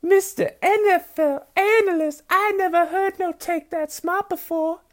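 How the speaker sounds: very high, slow Southern belle falsetto. Acted. Fan praising an NFL analyst, mock Southern belle. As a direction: lay on a syrupy Southern belle accent, stretching the words. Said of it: "I was doing that accent".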